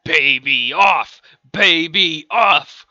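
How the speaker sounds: loud, slow, drawn-out chant; crowd shouting. Acted. Everyone in the room at a contest, shouting. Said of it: "Everyone in the room was shouting".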